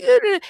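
put-on very high child whine; mock child whimper. The little kid whining over his dropped ice cream, high squeaky whine. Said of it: "the kid's like".

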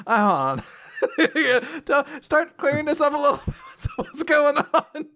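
laughing through words. Real, not acted. Host laughs through quote.

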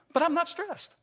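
high-pitched, squeaky protest; mock defensive denial. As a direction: deny it in a comically high voice. Acted denial of being stressed.

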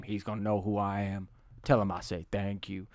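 low, hushed gruff man's voice; a lightly voiced wary tough guy; suspicious hero. Voices McConaughey on phone.

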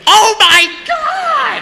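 imitating her horror in a high, drawn-out shout; mock shocked shriek. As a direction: shriek it slowly, hammed-up shock. Retelling her horrified shocked reaction.

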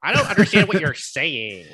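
loud, overdone American twang; exaggerated American accent. Imitating an Australian's American impression, overdone American accent. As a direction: overplay the baffled American. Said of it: "doing an American accent".

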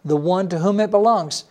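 ordinary talk

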